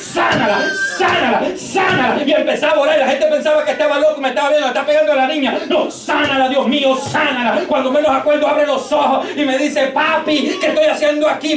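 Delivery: impassioned prayer climbing in pitch; fervent prayer preaching. Real, not acted. Father retelling his prayer for his sick daughter, fervent preaching. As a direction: pray and testify in an urgent, unbroken stream.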